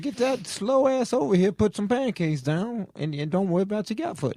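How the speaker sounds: high-pitched mock ordering-about; bossy demand. Son telling mom to make pancakes, high and bossy. Acts out bossing mom around.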